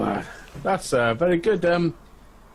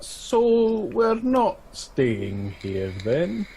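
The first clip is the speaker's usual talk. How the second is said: slow, pitched-up wry question; dry question; 5 semitones higher, much slower than usual. Acted. Player character questioning the plan, slow and wry. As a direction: drag it out, deadpan doubting.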